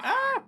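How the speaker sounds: sudden high-pitched yelp; acted scream. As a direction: mimic a startled game character's scream. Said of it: "your character panting or cussing or going".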